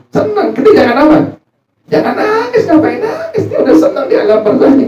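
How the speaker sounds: laughing. Real, not acted. Mocking advice about not crying.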